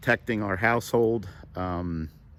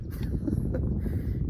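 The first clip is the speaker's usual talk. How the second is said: voiceless breathy chuckle; soft laugh; 6 dB quieter than usual. Real, not acted. Man amused by his joke about his dog, soft chuckle. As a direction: laugh quietly at your own joke.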